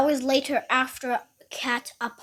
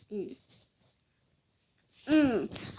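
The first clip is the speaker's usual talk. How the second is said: ordinary talk, nothing marked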